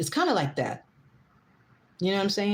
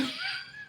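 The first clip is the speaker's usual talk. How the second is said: squealing laugh; 11 dB quieter than usual. Real, not acted. Laughing mid-sentence at commenters.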